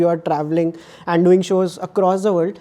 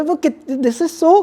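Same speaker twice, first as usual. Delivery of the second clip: mock Indian accent; 8 semitones higher, slower than usual. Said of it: "head bobbing करते हो ना, you go".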